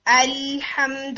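الحمد with the lam held long; full-contact lam. Shown as a demonstration. Teacher showing students the full articulation point, الحمد with unhurried lam. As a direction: give the l in الحمد time, slowly, full tongue contact. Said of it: "جب میں نے پورے مخرج کو touch کیا".

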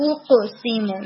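usual speaking voice

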